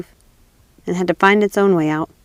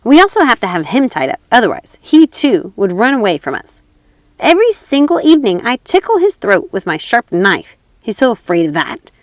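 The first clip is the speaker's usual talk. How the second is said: louder, gleeful menace; robber girl; 3 semitones higher, 4 dB louder than usual. Acted. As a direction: relish the knife, cruelly playful.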